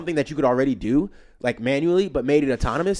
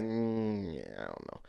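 soft, unsure mumble, trailing off; hesitant mumble; 6 semitones lower, 13 dB quieter, slower than usual. Retold or imitated. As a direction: mumble it low, backing down. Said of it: "and then I be like".

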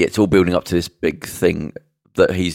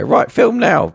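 pushy TV producer; 4 dB louder, slower than usual. Retold or imitated. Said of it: "and they go".

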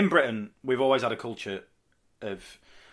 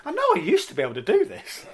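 flustered self-doubt; 12 semitones higher, 4 dB louder than usual. Acted. Continues acting the doubting wrestler.

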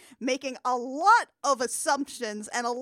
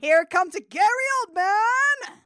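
excited fanfare; 8 semitones higher, 6 dB louder than usual. Pretend. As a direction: herald his grand entrance with long notes. Excited announcing of actor's entrance.

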